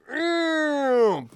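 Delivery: a tiny high-pitched Buzz squeal; toy squeak. Retold or imitated. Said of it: "Just like the film".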